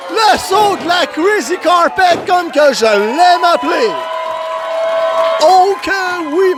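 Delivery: high, hyped call of the move; excited commentator. Real, not acted. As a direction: hype the big move to the crowd. Commentator hyping a signature move.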